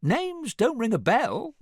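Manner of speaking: high, slow feigned innocence; squeaky mock-casual reply; nonchalant. A narrator's reading. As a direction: play dumb, stretching it out. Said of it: "said Gingy nonchalantly".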